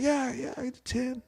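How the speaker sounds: high, soft, uneasy yes; bluffing agreement. Retold or imitated. Lying that he can do ten.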